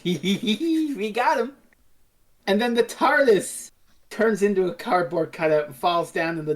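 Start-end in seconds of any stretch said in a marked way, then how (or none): 0.0-1.5 smug glee. Retold or imitated. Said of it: "shit-eating grins".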